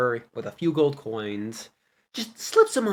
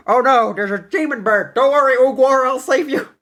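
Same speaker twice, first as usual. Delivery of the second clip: much higher, louder alarmed rescue cry; panicked hero; 10 semitones higher, 6 dB louder than usual. Retold or imitated. Bard crying out to save Uguay, mock-heroic panic. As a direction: rush in heroically to save your friend.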